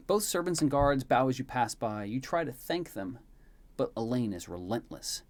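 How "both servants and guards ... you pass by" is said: usual speaking voice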